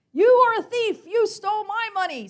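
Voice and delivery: a child shouting at his rival in mock court; yelling. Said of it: "yelling insults and accusations".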